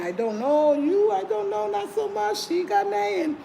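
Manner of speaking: high, mock-puzzled local voice, slowed at first; imitating Ghanaian locals. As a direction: play the doubtful local sizing her up. Locals judging her looks.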